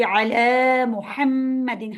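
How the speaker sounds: slow अला मुहम्मदिन with a stretched अला; long khadi zabar. Shown as a demonstration. Teacher showing careful recitation, slow. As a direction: hold the standing zabar in अला. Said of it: "खड़ी जबर का ख्याल नहीं कर रहे होते".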